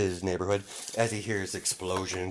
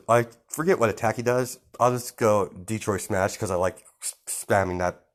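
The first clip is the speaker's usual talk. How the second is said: plain reading voice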